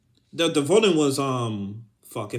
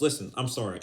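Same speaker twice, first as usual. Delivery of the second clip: ordinary talk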